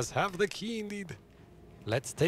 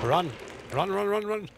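panicked; 5 semitones higher than usual. Real, not acted. Chased by limbs.

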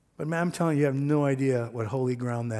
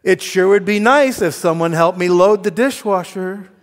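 pointed, loud and higher complaint; sarcastic; 5 semitones higher, 9 dB louder than usual. Retold or imitated. Wife hinting to husband for help, sarcastic. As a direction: imitate a loaded hint to a spouse. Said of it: "No sarcasm".

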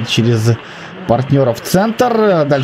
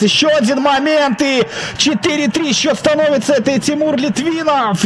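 excited goal call; 6 semitones higher than usual. Real, not acted.

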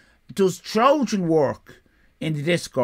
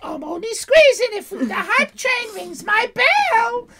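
put-on high-pitched gushing girl voice; giggly teenage girl; 13 semitones higher, 4 dB louder than usual. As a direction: play the overexcited teen streamer, high. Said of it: "do it more like the Giggly Girls".

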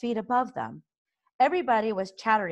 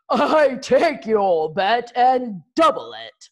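louder, higher, slow yell; 4 semitones higher, 7 dB louder, slower than usual. A narrator's reading. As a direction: shout the challenge back at the rival.

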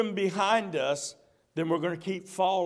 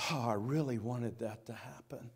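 soft; 7 semitones lower, 10 dB quieter than usual. Acted. The Lord's voice, quoted.

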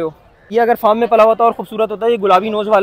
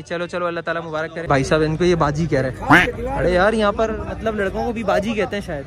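ordinary talk, nothing marked

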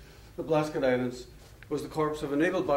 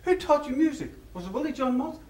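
high and louder, eager quiz; excited Irishman; 13 semitones higher, 4 dB louder than usual. Retold or imitated. Irishman quizzing his pub companion about teachers, high and excited. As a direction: fire the question with delight. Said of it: "getting more and more excited".

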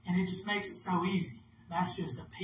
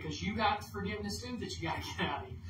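ordinary delivery

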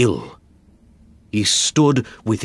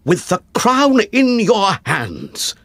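urgent accusation; 10 semitones higher, 4 dB louder than usual. Acted. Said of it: "I said".

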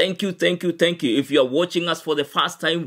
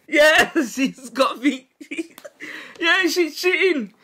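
high-pitched, laughing accusation; gleeful accusation; 11 semitones higher than usual. Real, not acted. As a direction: call out a cheat in a high, amused voice. Accusing partner of cheating, laughing.

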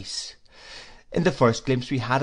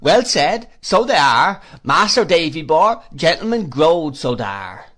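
loud, higher rustic cheering; excited cry; 4 semitones higher, 8 dB louder than usual. A narrator's reading. Ham eagerly agrees about the grown gentlemen, excited cry. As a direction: cheer him on, dropping at the end. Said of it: "cried Ham".

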